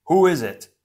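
loud, high, commanding challenge; a hero daring the hidden foe to show itself; bold call. Acted. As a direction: call out into the dark without fear. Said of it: "Tahu called boldly".

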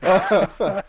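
high, loud burst of laughter; laughing hard. Real, not acted. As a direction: crack up completely.

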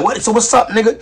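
retold street standoff, loud; mock challenge. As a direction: square up and shout it back. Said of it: "You like".

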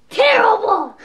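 outraged shout. Pretend. Host shouts at the contestant's awful answer. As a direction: burst out loud in mock outrage.

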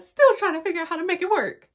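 high, loud, hurried mock wail; mock desperation. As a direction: exaggerate desperately, fast and high. Dramatizes her own desperation.

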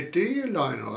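usual speaking voice

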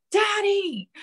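high shouted Daddy, thrilled; excited scream. A narrator's reading. Daughter shouting to her father about the offer, excited scream. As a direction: call out Daddy in delight. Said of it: "She was screaming".

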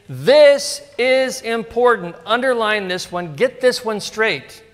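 slow, high, heavy stress on this; emphatic warning. Pretend. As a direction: press each word home.

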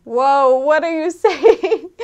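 laughing, high, loud disbelief; a loud, laughing whoa; baffled outsider. Retold or imitated. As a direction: play the lost outsider hearing a thick dialect. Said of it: "you're like".

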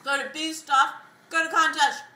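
loud and high through a mouthful; mouth full. Real, not acted. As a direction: urge them on, mouth stuffed. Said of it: "My mouth is stuffed".

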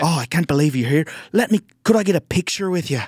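comic imitating the Irish fan begging for a selfie, Irish accent. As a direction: beg for a picture in an eager Irish accent. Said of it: "drunk Irish dude".

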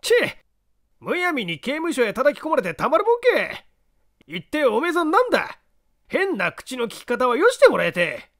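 high, brash slum-dweller voice; indignant street tough. Acted. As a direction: bristle with offended pride. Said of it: "ムッとした".

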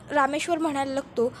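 ordinary talk, nothing marked

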